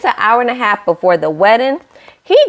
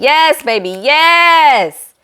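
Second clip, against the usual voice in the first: cheering; 7 semitones higher, much slower than usual. Retold or imitated. Vlogger cheering the groom's vows, excited. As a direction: hype the groom up like a fan. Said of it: "I said".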